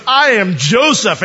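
high, loud, slow proclamation; dramatic revelation. Acted. Joseph springs the truth on his brothers, loud and dramatic. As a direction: announce it big, drawing the words out. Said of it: "he looks up at you and goes".

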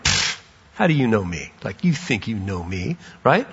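low, quiet, scoffing brush-off; dismissive scoff. Shown as a demonstration. Nathanael doubting the stranger who claims to know him, quiet scoffing brush-off. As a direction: wave off the stranger with a pfft. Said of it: "Could have been like".

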